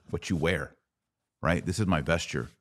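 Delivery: usual speaking voice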